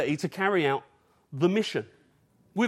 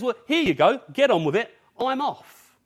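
higher, louder mock send-off; breezy brush-off; 7 semitones higher, 6 dB louder than usual. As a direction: toss off a quick, imagined goodbye. Imagined Jesus handing off the job.

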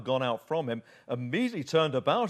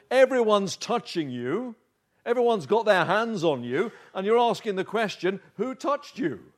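higher, louder, disbelieving protest; incredulous disciples; 5 semitones higher, 5 dB louder than usual. Acted. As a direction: act out the disciples scoffing at the question. Disciples scoff at Jesus' question.

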